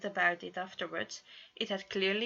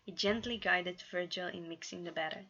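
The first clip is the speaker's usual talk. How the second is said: ordinary delivery, nothing marked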